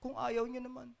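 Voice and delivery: soft mock prayer with no conviction; lifeless prayer. Preacher mimicking weak prayers for the sick, lifeless and soft. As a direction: pray limply, as if already giving up. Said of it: "Walang kabuhay-buhay ang mga panalangin".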